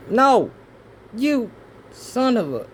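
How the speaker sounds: exasperated yell. Real, not acted. Pen lost again, exasperated.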